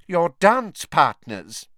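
high, loud and very slow; coldly. A narrator's reading. Professor spelling it out for a slow student, coldly. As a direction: spell it out deliberately, one word at a time. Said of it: "she said coldly".

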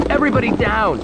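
high, urgent shouted command. A narrator's reading. Armed man orders a crowded lobby down, shouting. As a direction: shout a warning to a whole room. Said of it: "he shouted".

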